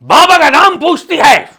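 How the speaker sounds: high, loud mock wrath easing at the end; angry. Comic holy man threatens the host who asked his name, angry outburst. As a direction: thunder a hammy curse. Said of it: "इतना गुस्सा करने वाली कौन सी बात".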